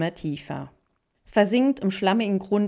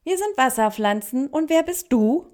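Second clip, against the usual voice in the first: high, louder character voice rising into the question; water plant voice; 7 semitones higher, 5 dB louder than usual. A narrator's reading. Water plants ask the newcomer who she is, high and curious. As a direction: answer as perky little plants, pitched well up.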